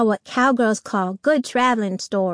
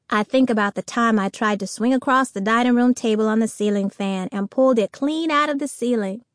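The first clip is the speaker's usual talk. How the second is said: usual speaking voice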